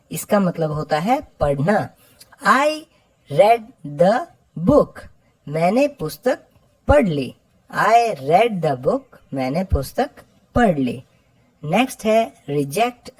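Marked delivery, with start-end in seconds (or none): 2.5-4.9 slow demo sentence. Shown as a demonstration. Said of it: "वो मैंने pronounce किया है रेड". 7.8-9.0 slow demo sentence. Shown as a demonstration. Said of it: "वो मैंने pronounce किया है रेड".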